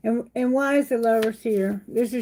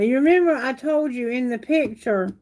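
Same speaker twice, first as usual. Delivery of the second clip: normal talking voice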